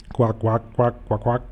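string of short duck quacks; imitated quacking. Philipp turned bird, answering the phone, imitated quacking. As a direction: quack repeatedly like a chattering duck.

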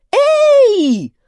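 very high, loud holler; long howl after a runaway; shrill full-voiced whoop; crowd's yell. A narrator's reading. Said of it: "хором завопили туземцы".

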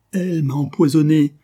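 high, loud pained cry of a poisoned man; anguished cry. Acted. Poisoned duke moaning on his bed, anguished loud cry. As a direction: cry it out in agony, writhing.

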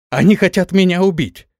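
loud, high cry of alarm; yelling. A narrator's reading. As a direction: shout an accusation for all to hear. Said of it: "заорала во всё горло Су".

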